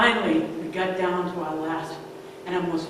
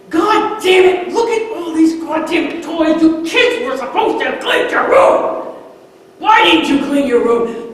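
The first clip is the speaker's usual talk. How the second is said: higher and much louder, a father's raging shout; furious yell; 10 semitones higher, 10 dB louder than usual. Retold or imitated. Father yelling at his daughters about the messy room, furious. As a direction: blow up at the kids over the mess.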